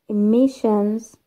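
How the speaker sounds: slow "Emissions", MIS drawn out; stress on second-last syllable. Shown as a demonstration. Teacher giving an example word, slow penultimate stress on "Emissions". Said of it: "Second from the end".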